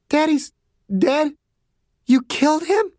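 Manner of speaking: stunned daughter turning accusing; stricken girl. Acted. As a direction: take in awful news in a high, hurt voice. Girl learns her father is dead.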